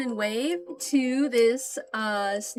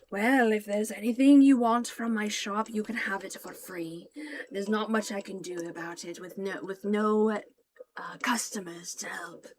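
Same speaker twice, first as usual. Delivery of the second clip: scarred shopkeeper. Acted. Scarred shopkeeper offering his wares free, sinking to a whisper. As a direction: give it away, then trail off into a whisper. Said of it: "he looks at you all and says".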